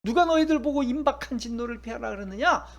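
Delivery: Jesus scolding the religious leaders, voiced with some heat; mock angry rebuke. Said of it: "화를 내시는 거예요".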